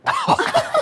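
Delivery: squealing laugh of disgust; high burst of laughter. Real, not acted. Smelling friend's belly button.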